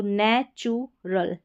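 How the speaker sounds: नेचुरल slowly split as "ne-chu-rul"; syllable by syllable. Shown as a demonstration. Teacher showing how U sounds, नेचुरल slowly split with "chu". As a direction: draw out नेचुरल in three pieces with a "choo". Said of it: "यू का ऊ की मात्रा के रूप में उच्चारण".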